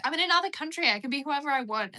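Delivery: high, loud and carefree; mock-thrilled teenager declaring freedom; imitating a giddy, self-important teen; excited younger self.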